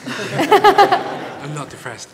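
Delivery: amused slow denial through a laugh; a real laugh running through the words. Real, not acted. Laughs while denying being depressed.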